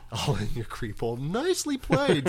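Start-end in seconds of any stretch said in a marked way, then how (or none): 1.2-2.3 mock posh praise.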